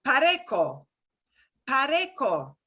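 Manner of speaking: "pareco" with a k sound before the o; hard C pronunciation. Shown as a demonstration. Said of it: "the way you would pronounce that".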